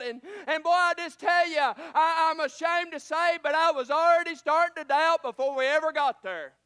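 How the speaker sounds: loud, driving preaching with shouted bursts; a preacher working up the congregation; fiery preaching. Acted. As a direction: testify to the church, full force. Preacher testifies at full force.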